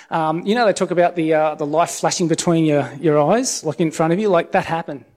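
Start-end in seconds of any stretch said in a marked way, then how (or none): none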